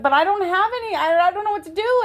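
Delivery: high-pitched flustered protest; retold fretting. Telling the bail bondsman she has no money, acted-out high fretting. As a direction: act out a worried, helpless plea. Retells her panicked call for bail.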